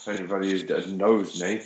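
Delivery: normal talking voice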